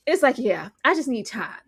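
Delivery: usual speaking voice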